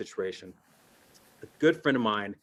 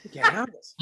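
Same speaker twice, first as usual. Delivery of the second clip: panicking daughters; 4 semitones higher than usual. Retold or imitated. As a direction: squeal it like a panicked kid losing pocket money. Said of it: "They're like".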